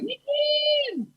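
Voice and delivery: high, soft, held squeal; giddy squeal. Retold or imitated. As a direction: squeal it long through closed lips. Excited squeal, retelling himself in theater.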